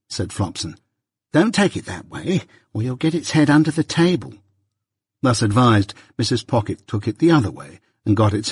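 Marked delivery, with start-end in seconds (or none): 1.3-4.3 higher-pitched, lightly voiced warning nurse; a touch of nursemaid's fussing; scolding nursemaid. A narrator's reading. Nurse warning the mother about baby's head, mildly scolding. Nurse warns clumsy mistress.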